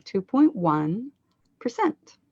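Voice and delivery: slow, lean on one, then a high percent; end-of-phrase stress. Shown as a demonstration. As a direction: stretch one, pause, lift percent sharply. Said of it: "it's the point one that ends the phrase".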